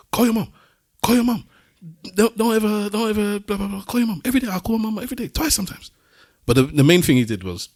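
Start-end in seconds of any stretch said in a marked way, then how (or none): 0.1-5.9 very high, urgent Moroccan voice; high Moroccan-accented nagging, quickening towards the end; imitated Moroccan accent. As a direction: plead insistently in a put-on Moroccan accent. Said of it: "he's saying this in his accent".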